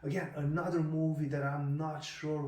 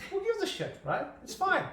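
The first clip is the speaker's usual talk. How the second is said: much higher mock-dismissive voice. Host mocking accent fussiness, high dismissive voice. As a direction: toss it off, pitched way up, mocking. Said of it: "I'm like".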